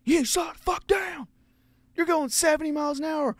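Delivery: high, loud angry shout; angry vet shout. Retold or imitated. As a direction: bark it like the furious vet. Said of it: "and he's like".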